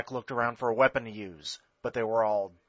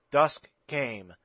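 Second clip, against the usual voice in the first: ordinary delivery, nothing marked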